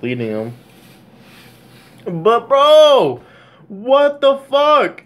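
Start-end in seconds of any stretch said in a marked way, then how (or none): 2.0-3.2 higher and louder, fed-up bro call-out; exasperated outburst. Real, not acted. Exasperated fan ranting at team. 3.7-5.0 "what the fuck" drawn out very high and loud, slow; exasperated yell. Real, not acted. Exasperated fan ranting at team.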